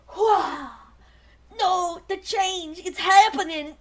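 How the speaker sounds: high, loud, hammed-up cry; mock werewolf transformation. Voicing Rat Trap turning into a were-creature, hammed-up panic. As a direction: ham up a panicked change. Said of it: "A nice little woman is just".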